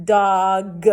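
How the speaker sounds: dog with the G hit loud at the end; punched ending sound. Shown as a demonstration. Teacher demo for kids, final G punched. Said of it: "I punch up".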